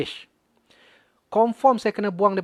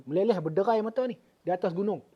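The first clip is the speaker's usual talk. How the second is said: usual speaking voice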